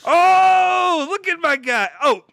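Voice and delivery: sudden loud, high cry of delight; excited shout. Real, not acted. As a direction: shout with surprised joy. Gifted a membership, cries out.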